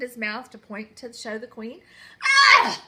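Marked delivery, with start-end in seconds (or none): 2.2-2.8 high, loud yell of disgust; a high, loud royal shriek; queen's shriek. Acted. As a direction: shriek at the open mouth. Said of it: "yelled Queen Dentina".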